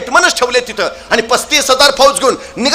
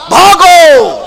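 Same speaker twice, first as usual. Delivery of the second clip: loud, higher shouted run for it; comic shout to flee; 6 semitones higher, 7 dB louder, much slower than usual. Acted. Marathas throw it into reverse and flee, comic shout. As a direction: yell the retreat with comic urgency.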